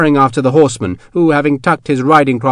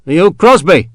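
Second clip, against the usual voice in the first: irritated; 4 semitones higher, 5 dB louder than usual. A narrator's reading. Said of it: "in an irritated voice".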